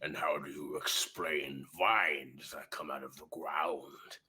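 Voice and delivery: low whispered interrogation; menacing orc whisper. Acted. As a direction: hiss the question at the human, cold and slow. Orc leader interrogates player.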